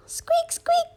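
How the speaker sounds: very high squeaking; squeaky animal noise. A narrator's reading. Skeleton animal in the zoo, squeaking high. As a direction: squeak like a tiny creature.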